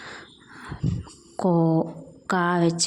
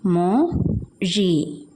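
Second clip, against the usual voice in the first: slow syllables; much slower than usual. Shown as a demonstration. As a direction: split into two slow syllables. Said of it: "syllable by syllable for children". (പതുക്കെ അക്ഷരം തിരിച്ച്)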